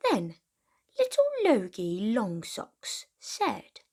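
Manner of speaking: usual speaking voice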